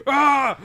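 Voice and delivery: sudden high, loud angry yell "Ah"; a scream that sounds mad, not excited. Retold or imitated. Retelling his ride scream with an angry yell. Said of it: "My scream just sounds angry".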